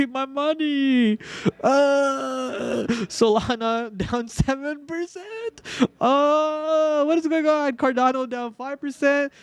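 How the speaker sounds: put-on high whiny baby voice, calling for mommy; mock crying baby. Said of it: "I'm crying right now".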